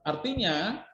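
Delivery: usual speaking voice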